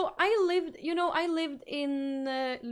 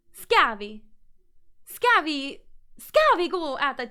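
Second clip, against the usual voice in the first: loud, hammed-up Gothenburg accent try; mock Gothenburg accent; 7 dB louder, slower than usual. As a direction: belt out a clumsy Gothenburg accent, louder. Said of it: "ska vi försöka prata med göteborgska nu då".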